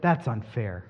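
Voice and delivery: low, soft, sulky grumble; sour complaint. Pretend. Preacher voicing a listener's resentful reaction, sulky. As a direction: mutter the objection, aggrieved. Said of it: "Or is it".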